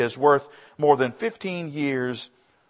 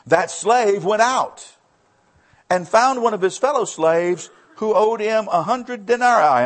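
raised, driving Bible reading; scripture reading; 8 semitones higher, 7 dB louder than usual. Acted. Preacher reading the parable to the congregation, raised and driving. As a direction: read the verse out to the church.

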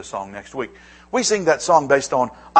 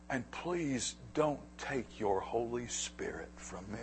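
whispered, breathy plea for the Spirit; hushed prayer; 2 semitones lower, 14 dB quieter than usual. Retold or imitated. Preacher voicing David, whispering his plea to God.

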